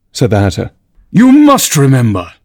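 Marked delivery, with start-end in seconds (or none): 1.1-2.3 raised, forceful royal demand; insistent king. A narrator's reading. The king presses the Hatter to remember, forcefully.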